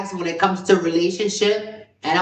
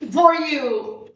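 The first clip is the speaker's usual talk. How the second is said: cheering for you; 8 semitones higher than usual. Retold or imitated. Friend in love, cheering.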